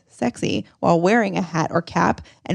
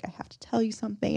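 hushed, quicker mock-serious confession; retold confession, said softly and gravely; 3 semitones higher, 8 dB quieter, much faster than usual. Boyfriend confessing to his girlfriend about the hat, mock-serious. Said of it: "very serious confession".

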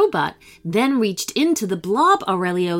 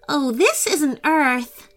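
exclaiming; 7 semitones higher, slower than usual. Acted. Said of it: "Ailani exclaimed".

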